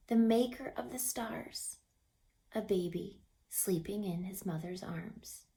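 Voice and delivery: soft, tender, awed narration; hushed reverence. A narrator's reading. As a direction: speak softly beside a sleeping baby. Reverent moment at the manger.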